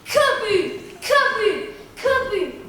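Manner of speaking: loud, high booms falling away; booming sound effect. Acted. Weak pupils going down behind you, loud booming thuds. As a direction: boom it out like bodies hitting the floor.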